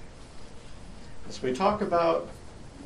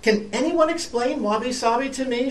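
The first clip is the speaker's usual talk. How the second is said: high, louder pleading cry; 9 semitones higher, 5 dB louder than usual. Acted. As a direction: cry out the question, lost. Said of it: "she cried".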